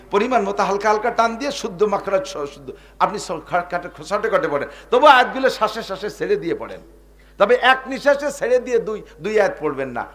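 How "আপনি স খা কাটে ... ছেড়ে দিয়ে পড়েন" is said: ordinary delivery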